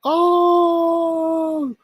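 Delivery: high, slow triumphant singsong voice; gloating singsong. Acted. Streamer gloating to chat that he was right, high and slow. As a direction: crow it high and slow like a victory chant.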